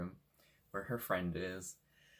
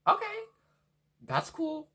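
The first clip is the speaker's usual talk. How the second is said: sarcastic; 9 dB louder than usual. Retold or imitated. Sarcastic reply to doctor's news.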